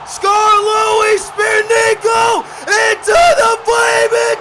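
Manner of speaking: loud, high excited match call; live play-by-play. Acted. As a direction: scream the big spots to the crowd.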